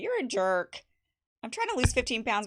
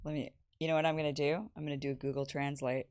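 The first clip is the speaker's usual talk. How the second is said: usual speaking voice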